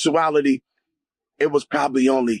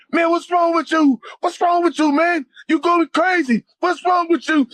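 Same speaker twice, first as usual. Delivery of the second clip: worried friend. Retold or imitated. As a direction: shake him awake and demand what's wrong. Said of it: "I remember him saying".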